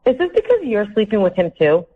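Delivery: normal talking voice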